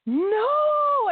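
a high, loud wail of no; anguished cry. Retold or imitated. Realising the series is over, she wails in anguish. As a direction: cry it out as the show ends for good. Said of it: "And I was like".

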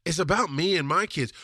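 usual speaking voice

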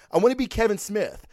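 usual speaking voice

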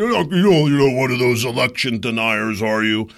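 sneering, put-on voice of a smug acquaintance; mocking quote. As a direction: mimic someone needling him about elections.

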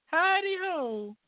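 very high, slow squeaky cartoon poo voice; Mr. Hankey impression. Host answers cohost with a squeaky Mr. Hankey greeting. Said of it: "a Mister Hanky from South Park".

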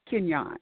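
Kenyon as kin-YAHN, falling tone; correcting pronunciation. Shown as a demonstration. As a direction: show Kenyon with a y glide and open "ahn". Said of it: "It's pronounced".

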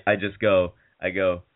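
normal talking voice

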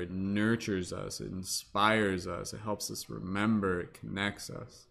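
calm guide easing everyone inward; guided meditation. Acted.

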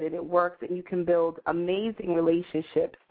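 ordinary delivery

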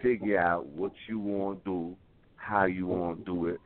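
normal talking voice

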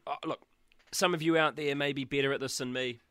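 ordinary talk

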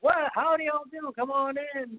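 high, hammed-up hillbilly welcome; Sid Haig impression. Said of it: "You would expect, like, um, Sid Haig to be behind there".

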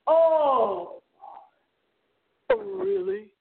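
light imitation of a dramatic radio host, really rising high; a hint of an eerie radio host reacting to a guest; Art Bell's tone. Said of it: "That's the tone of voice Art Bell used".

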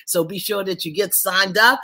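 usual speaking voice

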